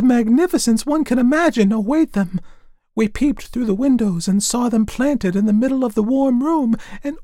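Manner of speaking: very high, loud chirpy sparrows; chirping sparrows. A narrator's reading. Sparrows tell the fir tree in very high chirps. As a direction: chatter it out like little birds. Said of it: "chirped the sparrows".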